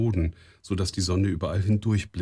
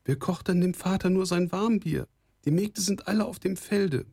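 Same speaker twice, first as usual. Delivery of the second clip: put-on sustained high girl's voice; high-pitched, anxious maiden; worried daughter; 12 semitones higher than usual. A narrator's reading. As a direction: fret in a high, girlish voice.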